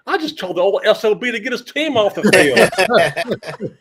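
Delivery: slow, loud, laughing boast; mock brag. Telling the producer what he said to Bryant, slow laughing boast. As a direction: savour each word like a cheeky triumph.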